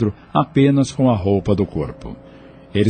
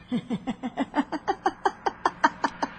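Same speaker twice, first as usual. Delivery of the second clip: high, breathy sarcastic snicker from offstage; a mocking laugh at the prisoner; 12 dB quieter than usual. Villainess laughs sarcastically at the jailed doctor. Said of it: "ouviu um riso sarcástico".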